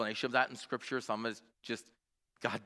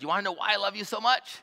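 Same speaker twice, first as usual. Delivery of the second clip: put-on higher, eager child asking; little girl voice; 9 semitones higher than usual. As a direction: lift it like a curious young daughter. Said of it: "And she said".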